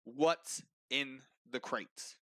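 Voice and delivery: very slow, word by word; insistent questioning. Acted. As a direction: space out each word with authority. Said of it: "And so he says again".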